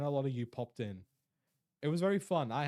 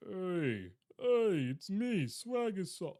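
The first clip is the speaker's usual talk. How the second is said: higher put-on greeting voice; attempted impression; 4 semitones higher, 3 dB louder, much slower than usual. Shown as a demonstration. Streamer attempting another impression, put-on voice. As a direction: announce yourself in a higher put-on voice. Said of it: "Why did I attempt that?".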